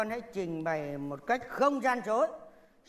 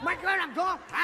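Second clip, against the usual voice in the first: angry demand; 6 semitones higher, faster than usual. Acted. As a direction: shout the question at her. Husband repeats order angrily.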